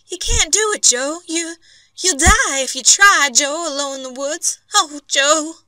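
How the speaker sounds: wife terrified her husband will die; frightened pleading wife. A narrator's reading. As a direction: beg him not to go. Wife begging Joe to stay.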